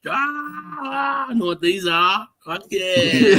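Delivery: high, slow, drawn-out gleeful whoop; soaring high as it clicks what these are; excited cry. Retold or imitated. Retelling his walk to a friend, high drawn-out gleeful whoop. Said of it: "and I was like".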